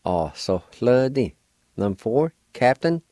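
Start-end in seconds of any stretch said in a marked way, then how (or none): none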